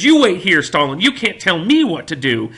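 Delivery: loud, high defiant shouting; retold yell with a Southern lilt. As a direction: stand up to the bully at full volume. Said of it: "he starts yelling at Stalin".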